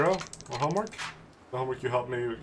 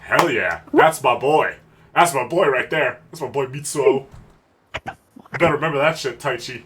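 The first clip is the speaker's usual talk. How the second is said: loud triumphant bragging; loud gloating hype; proud cheering; 10 dB louder, slower than usual. Acted. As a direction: cheer on your boy like a proud dad.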